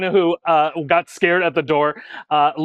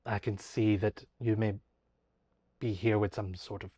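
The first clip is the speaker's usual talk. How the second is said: a proud swordsman backing down quietly; hushed, sincere apology from a gruff fighter; humbled apology; 7 semitones lower, 9 dB quieter than usual. Acted. GM voices Xander, softened.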